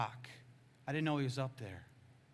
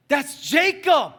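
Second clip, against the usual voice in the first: high, slow yell; shouted revelation; 12 semitones higher, 16 dB louder, much slower than usual. Retold or imitated. Friend telling the preacher who the boy is, shouting. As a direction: yell it with each word stretched. Friend's realisation, retold at full volume.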